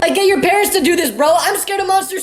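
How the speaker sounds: terrified refusal. Retold or imitated. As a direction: freak out like a scared kid begging off. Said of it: "Dog, I wanted to say".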